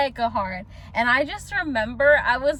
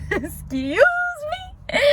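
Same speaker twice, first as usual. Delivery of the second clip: sassy disbelief; slower than usual. Retold or imitated. Repeats retort, more sass.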